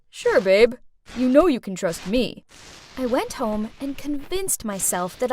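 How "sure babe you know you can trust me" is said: a louder, put-on cool boyfriend voice; smooth boyfriend. A smooth-talking boyfriend tells his girlfriend he'll repay her. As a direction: play the slick boyfriend reassuring her.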